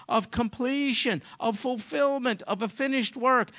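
usual speaking voice

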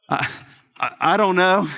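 halting, evasive "I don't know"; a cornered dad brushing it off; mock innocent stammer; evasive denial. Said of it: "they know when I'm angry".

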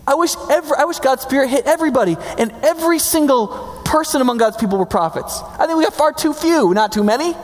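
high, slightly fast insistence; exasperated Moses. Retold or imitated. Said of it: "And Moses is like".

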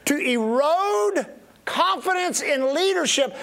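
to erode drawn out very slowly, each syllable spaced; slow right down on to erode so it sinks in; slow, deliberate emphasis. Shown as a demonstration. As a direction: stretch to erode out for weight. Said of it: "now listen to me carefully".

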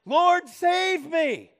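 loud, high, slow desperate cry; cry for help. Acted. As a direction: cry out like a sinking man. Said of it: "he cried out".

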